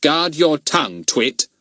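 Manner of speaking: mildly stern adult-style warning; firm, authoritative reproof; grown-up. A narrator's reading. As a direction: warn him like a grown-up. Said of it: "still in his grown-up voice".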